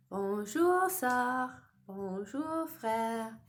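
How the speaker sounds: "Bonjour, sœur. Bonjour, frère." said in French as a group echo; French greeting together. Shown as a demonstration. Teacher saying French greetings along with the children. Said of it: "Let's say it all together".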